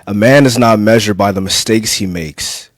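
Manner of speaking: spoken-word monologue in short, weighted phrases; dramatic poem recital. Acted. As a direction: declaim each phrase firmly, then let it rest. Recites his own poem as Adam.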